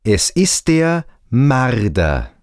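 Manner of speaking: ordinary talk, nothing marked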